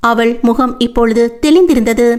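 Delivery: usual speaking voice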